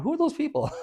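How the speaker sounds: high, puzzled neighbor voice; curious neighbor. Retold or imitated. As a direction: pitch it way up in mock puzzlement.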